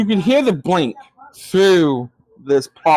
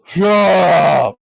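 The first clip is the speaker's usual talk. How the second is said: loud shout, a touch louder; giant yelling; 4 dB louder than usual. Acted. Giant shouting again at the quarrelling goblins, loud. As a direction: bark it at the noisy goblins. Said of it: "As you hear this thing yell".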